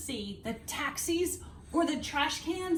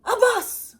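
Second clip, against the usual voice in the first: high, loud cry of a bus; excited discovery; 10 semitones higher, 9 dB louder than usual. A narrator's reading. Narrator bursting with joy as Truman hears the bus.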